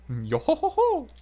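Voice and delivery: high, loud "yo ho ho" laugh; Brook from One Piece. Retold or imitated. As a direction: skeleton pirate's sing-out cackle. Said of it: "a Brook from One Piece laugh".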